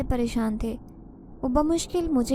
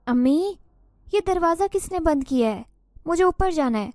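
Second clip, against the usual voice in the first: shouting; 6 semitones higher than usual. A narrator's reading. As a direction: cry out to mother upstairs. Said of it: "میں چلا کر امی سے کہنے لگی". (چلا کر، اونچی آواز میں)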